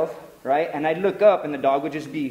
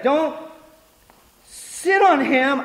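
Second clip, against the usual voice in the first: high, slow, loud mock scolding; scolding girlfriend; 9 semitones higher, 5 dB louder, much slower than usual. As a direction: imitate her outraged order, drawn out. Girlfriend snatches dog, scolding.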